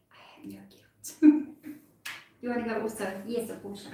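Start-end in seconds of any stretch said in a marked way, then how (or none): 0.1-1.3 hushed unvoiced whisper. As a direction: whisper it like a guilty secret. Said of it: "She's just like".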